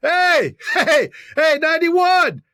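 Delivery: high, stretched-out holler; needling high-pitched fan yell; mock heckler call. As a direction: call out to a player across the field. Acting out Arrowhead heckler calling player.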